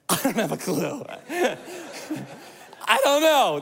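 real laughter breaking through; chuckling helplessly; laughing. Real, not acted. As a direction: laugh through I don't know. Laughing at own joke.

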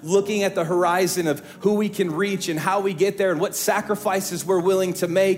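full-force preaching. Acted. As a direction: preach it hard to the whole church. Pastor preaches to congregation.

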